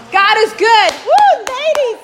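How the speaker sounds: loud whooping celebration; real excitement. Real, not acted. Preacher cheering to the ladies that God is good, excited. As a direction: burst out high and loud to rally the ladies. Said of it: "It's so exciting".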